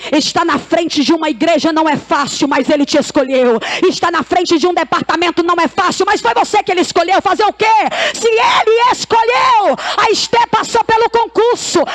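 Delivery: exhortation climbing in pitch toward a shout; rally the women, driving harder as you go; impassioned preaching. Real, not acted. Preaches at full force. (pregação empolgada, crescendo até o grito)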